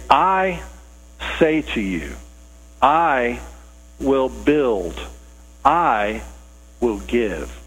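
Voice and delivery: weighty pauses, each I set apart; slow, measured declaration in short phrases; solemn Jesus quote. Acted. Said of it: "We hear Jesus saying that".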